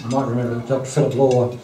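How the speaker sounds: usual speaking voice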